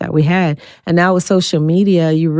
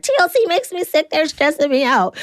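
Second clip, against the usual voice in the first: mock whiny complaint; 10 semitones higher than usual. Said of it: "I was like".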